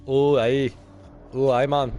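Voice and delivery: loud mock Welsh accent. Shown as a demonstration. Player showing off his accents to co-host, mock Welsh. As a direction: put on a rough Welsh accent, loudly. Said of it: "Here's Welsh".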